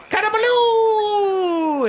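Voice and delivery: high, slow, drawn-out magic word; gnome spell word. Acted. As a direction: stretch the incantation out like a showman gnome. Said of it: "Gilly waggles his suspicious gnome fingers and says".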